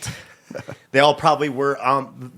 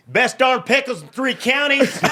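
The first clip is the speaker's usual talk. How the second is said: a higher, slow, folksy call from the back; mock yell; 10 semitones higher than usual. Heckler in the back boasting of the pickles, mock folksy yell. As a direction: boast it across the room, drawn out. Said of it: "somebody yells in the back".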